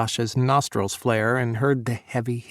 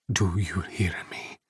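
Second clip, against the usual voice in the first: soft, lower whisper; 5 semitones lower, 5 dB quieter than usual. A narrator's reading. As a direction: lean in and whisper it. Intimate plea to Sasha.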